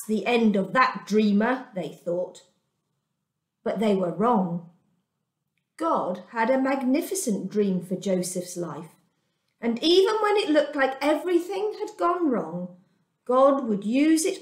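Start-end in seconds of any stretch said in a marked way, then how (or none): none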